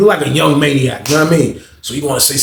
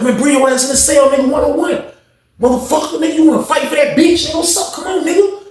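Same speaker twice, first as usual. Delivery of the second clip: imitating someone itching to fight; mock fight challenge; 13 semitones higher than usual.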